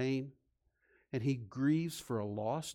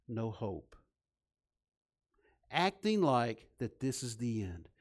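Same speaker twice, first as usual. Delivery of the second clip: ordinary talk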